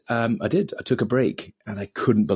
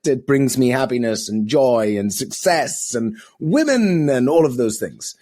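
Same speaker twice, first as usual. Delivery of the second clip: higher, louder mock insistence; defensive denial; 4 semitones higher, 3 dB louder than usual. Guest mocking how he defended drinking, insisting loudly. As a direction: protest a bit too much, in a raised voice. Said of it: "And you go".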